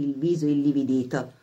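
plain reading voice

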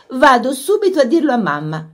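put-on high, loud tattling child; childish threat. A narrator's reading. Laura to Jose, a childish tattling threat, high and loud. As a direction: threaten like a little sister. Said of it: "proprio come erano state solite dire".

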